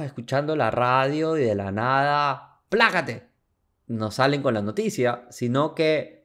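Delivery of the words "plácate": sudden interjection. Pretend. Sudden jolt in imagined surprise.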